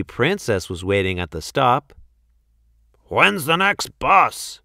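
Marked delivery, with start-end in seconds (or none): 3.0-4.6 grunting monkey. Acted. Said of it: "he grunted".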